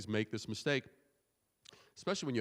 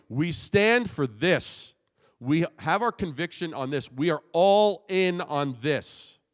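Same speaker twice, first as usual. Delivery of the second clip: loud and slow, full conviction; loud declaration; 4 semitones higher, 7 dB louder, slower than usual. Shown as a demonstration. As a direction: proclaim it like a manifesto.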